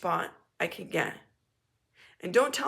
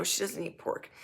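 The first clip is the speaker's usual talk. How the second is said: ordinary talk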